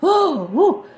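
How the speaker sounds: high, loud swooning "woo"; high-pitched lovestruck outburst; swooning exclamation. Retold or imitated. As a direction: let out a loud, smitten whoop. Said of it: "And it's like".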